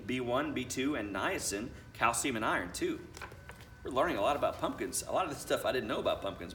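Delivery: ordinary delivery, nothing marked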